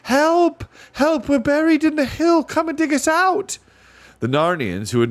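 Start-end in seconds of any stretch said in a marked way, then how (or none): 0.0-3.6 high, loud cries for help; girl yelling up from a hole to dancers. A narrator's reading. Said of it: "yelled to the dancers".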